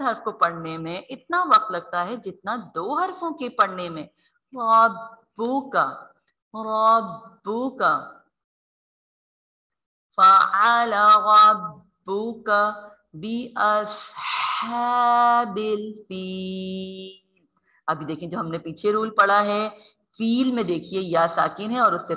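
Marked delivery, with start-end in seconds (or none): 4.5-8.1 slow रब्बुका with a long, firm ब्ब; held ba. Shown as a demonstration. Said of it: "सबसे ज़्यादा वक्त बा को पढ़ने में". 10.2-17.5 slow melodic tajweed chant; Quran recitation. Acted. Recites the verse.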